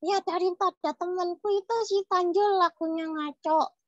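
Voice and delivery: put-on high childlike character voice; cheeky puppet child. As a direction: chatter as a little puppet kid. (suara boneka anak yang usil)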